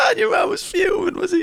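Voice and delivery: high laughing question; teasing through laughter; high amused prod. Real, not acted.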